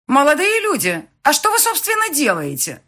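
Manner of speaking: loud, high outraged question; indignant woman. A narrator's reading. Registry clerk confronting flower pickers, indignant. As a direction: call out in shrill-pitched indignation. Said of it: "с возмущением спросил наш женский голос".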